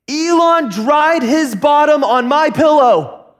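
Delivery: high, loud indignant complaint, drawn out; enraged. Retold or imitated. Daughter reporting brother to father, outraged wail. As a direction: imitate an outraged kid tattling, stretching each word. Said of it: "enraged and indignant and face is red".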